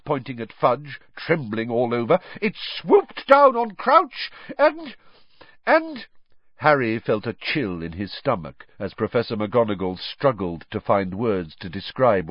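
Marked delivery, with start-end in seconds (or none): 2.4-6.1 horrified scream. Acted. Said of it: "she screamed".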